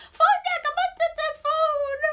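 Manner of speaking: high, loud, slow desperate scream; screaming and crying at home to siblings. Retold or imitated. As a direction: scream it out in panic. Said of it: "I'm screaming".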